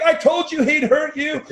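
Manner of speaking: high, loud, slow teasing taunt; sing-song told-you-so from a teammate; mocking teammate. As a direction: rub it in like a laughing teammate. Pepe is laughing at me.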